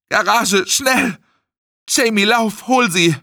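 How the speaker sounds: high, frantic shouted orders; urgent bellowing. A narrator's reading. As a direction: shout commands in an emergency. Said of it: "brüllte er". (hektisch gebrüllte Befehle)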